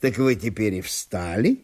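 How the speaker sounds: soft, lazy reclining voice; host on the floor apologises softly to his guest; lazy host apologising. A narrator's reading. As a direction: apologise without getting up. Ivan Nikiforovich asks.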